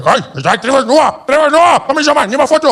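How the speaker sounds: put-on high, excited Afrikaans-accented call; second Afrikaans guy. Another Afrikaans fan demanding a photo, excited Afrikaans accent. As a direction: shout eagerly in a thick Afrikaans accent. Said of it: "some other Afrikaans guy".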